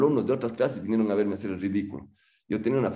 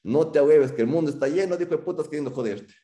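louder and higher, gruff fatherly push; dad's blunt advice; 6 semitones higher, 4 dB louder than usual. Retold or imitated. Father urging his son not to give up, loud and blunt. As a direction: shout it like a dad firing up his son. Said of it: "Mi papá".

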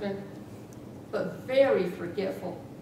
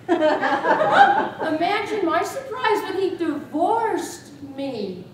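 slow, higher and louder with laughter; laughing mock indignation; 6 semitones higher, 7 dB louder, much slower than usual. Widow laughing that her husband escaped by divorce. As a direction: drag it out through laughter.

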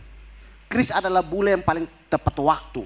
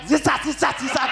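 higher sing-song zizat, zizat, zizat; sung starter noise; 5 semitones higher than usual. Retold or imitated. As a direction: chant zizat like a buzzing starter. Imitating the motorbike starter noise. (bunyi zizat dinyanyikan)

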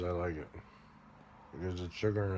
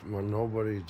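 usual speaking voice

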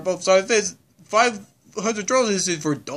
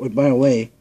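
ordinary talk, nothing marked

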